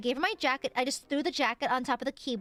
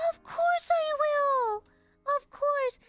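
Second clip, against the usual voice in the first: high and slow, bursting with eager agreement; eager yes; 13 semitones higher, 4 dB louder, slower than usual. Acted. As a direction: gush your yes.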